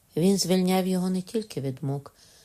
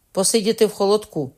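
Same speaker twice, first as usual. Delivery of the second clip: louder, higher cheeky reply; a prisoner mocking his judge; defiant retort; 6 semitones higher, 7 dB louder than usual. A narrator's reading. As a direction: toss the answer back defiantly.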